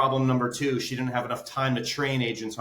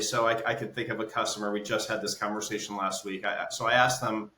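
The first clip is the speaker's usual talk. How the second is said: ordinary talk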